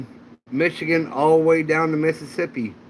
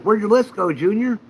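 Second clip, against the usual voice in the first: incredulous; 8 semitones higher than usual. Retold or imitated. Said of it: "I'm like".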